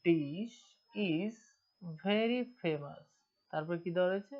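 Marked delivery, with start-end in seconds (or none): 0.0-3.0 each word of the sentence read out very slowly; slow reading aloud. Shown as a demonstration. Teacher reading the exam line slowly to students. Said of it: "এইখানে কি দেওয়া আছে বলো তো".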